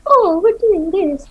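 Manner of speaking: high, slow, exaggerated sing-song; mock eager reply. Huntress mocking how people answer the queen, high and slow. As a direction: play the naive people, hammed up. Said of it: "people are like".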